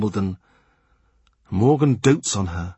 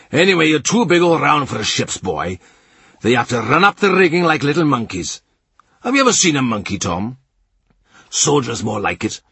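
higher, louder jovial teasing; Morgan teasing; 3 semitones higher, 4 dB louder than usual. A narrator's reading. Morgan mocking Tom's ship's boy plan, loud and high. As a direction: rib the boy loudly.

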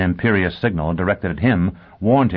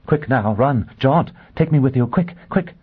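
rising higher with each command; urgent plea; 7 semitones higher than usual. A narrator's reading. Woman begging her guest to escape with her, urgent. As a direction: build to a high, panicky urging. Begs to escape falling missiles.

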